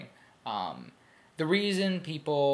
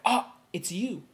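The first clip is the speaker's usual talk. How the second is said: a higher mock anime "Ah, it's you"; mock anime line; 8 semitones higher than usual. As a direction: play it like a surprised anime hero. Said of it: "what it means, it means like".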